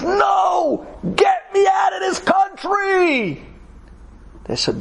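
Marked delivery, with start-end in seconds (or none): 0.0-3.4 high, slow, pleading yell; desperate shout. Retold or imitated. Stricken man shouting at airport staff to fly him home. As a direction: cry out in terror to escape. Sick man refuses hospital.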